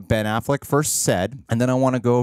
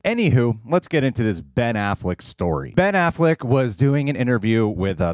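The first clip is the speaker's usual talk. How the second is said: ordinary talk, nothing marked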